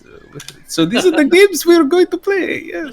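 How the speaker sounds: high put-on merchant voice, sly; coy merchant. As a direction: tease like a cagey shopkeeper. Merchant NPC being coy.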